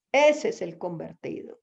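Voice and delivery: ordinary talk, nothing marked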